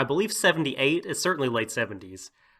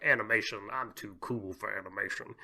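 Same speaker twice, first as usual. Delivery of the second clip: mildly dismissive, trailing off softer; lightly mock your aloof younger self; too-cool teenager; 2 semitones lower, 8 dB quieter than usual. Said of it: "high school where I was like".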